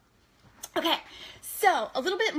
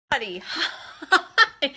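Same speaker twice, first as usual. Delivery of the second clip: slow, louder hi with a laugh; laughing greeting; 3 semitones higher, 8 dB louder, much slower than usual. Real, not acted. Greeting a viewer with a slow laughing hi. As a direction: laugh as you greet a friend.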